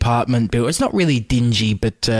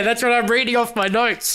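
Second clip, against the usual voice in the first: high-pitched, laughing voice; laughing admission; 10 semitones higher than usual. Real, not acted. Host admits to co-host the notes mix names too, laughing hard. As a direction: talk through real laughter, much higher.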